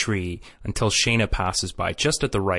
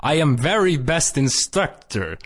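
higher, mock Swedish broken English; hammed-up Swedish accent; 5 semitones higher than usual. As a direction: imitate a bad cartoon Swedish accent. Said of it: "hilarious broken English".

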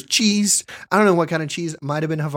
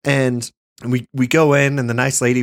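ordinary delivery

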